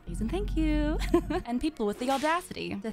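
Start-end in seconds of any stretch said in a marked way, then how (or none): 0.1-1.0 higher, louder sing-song please; mock sweet. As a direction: saccharine fake politeness. Mocking sweet politeness to heroes.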